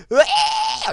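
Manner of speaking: very high imitated screech; mock screeching noise; horrible high-pitched screech. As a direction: squeal like distorted microphone feedback. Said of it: "really horrible sounding high-pitched screech".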